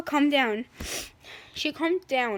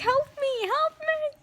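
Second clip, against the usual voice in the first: high, drawn-out wail; mock cry for help; 11 semitones higher, slower than usual. [name] under attack begging Maya, high mock wail. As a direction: wail for rescue, hammed up.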